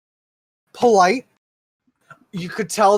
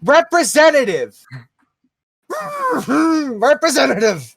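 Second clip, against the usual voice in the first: mock scream; 7 semitones higher than usual. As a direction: bellow it at the phone menu. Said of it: "I start screaming".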